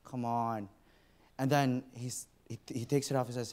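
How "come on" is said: soft, slow muttered exasperation; quiet impatient sigh. Retold or imitated. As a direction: grumble under the breath. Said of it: "So I'm like".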